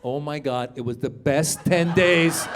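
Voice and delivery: usual speaking voice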